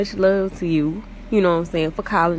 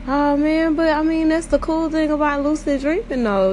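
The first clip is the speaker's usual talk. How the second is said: loud, higher excited burst; 8 semitones higher, 4 dB louder than usual. Real, not acted. Host to listeners, excited about lucid dreams.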